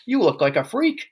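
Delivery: high-pitched scolding shriek. A narrator's reading. Mother berating her husband over his hair, shrieking. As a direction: berate him in a high, swooping voice. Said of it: "shrieked the mother".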